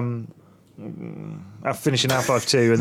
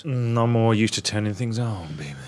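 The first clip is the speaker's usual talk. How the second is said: nighttime voice. Pretend. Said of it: "Stop using your nighttime voice".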